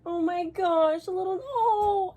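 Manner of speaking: high, slow, teary gasp of joy; choked up by the gift, real; tearful. Real, not acted. Overwhelmed opening friend's gift.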